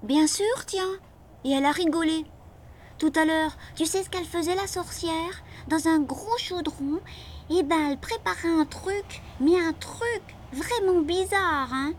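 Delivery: put-on high-pitched child's voice throughout; little boy confiding. A narrator's reading. Little boy telling his friend about the cauldron, high voice. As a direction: tell a strange discovery in a high boyish voice.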